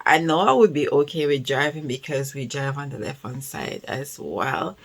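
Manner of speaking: usual speaking voice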